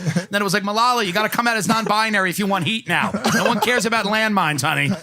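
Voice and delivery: oily agent selling hard, laughing through it; sleazy agent. Pretend. Pretend agent pitching to a young activist, put-on sleazy voice. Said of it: "this agent who was like".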